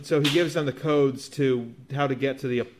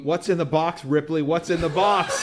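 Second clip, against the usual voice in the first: raised, pleading movie-parody voice; mock frantic demand; 4 semitones higher, 4 dB louder than usual. Riffing on Alien seven to co-hosts, mock frantic demand. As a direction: ham up the demand, stretching the last repeat. Parody of a famous film line.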